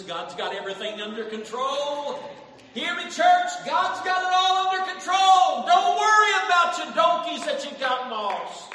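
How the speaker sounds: loud, high, half-sung preacher's cry; full-force preaching. Acted. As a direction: whoop it out to the church.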